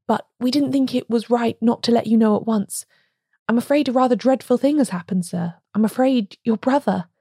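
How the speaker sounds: usual speaking voice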